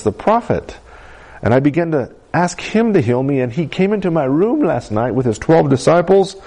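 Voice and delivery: high, eager retelling to a parent; excited. Retold or imitated. Healed girl explains to her dad, excited and high. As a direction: pour out the good news. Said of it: "she just was so excited".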